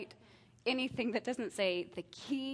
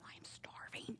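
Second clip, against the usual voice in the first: whispered, much softer, guilty snacker; sneaky whisper; 14 dB quieter, slower than usual. Pretend. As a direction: hush it like you're hiding a treat. Parent sneaking food before dinner.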